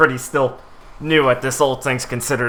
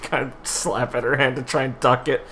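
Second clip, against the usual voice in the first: usual speaking voice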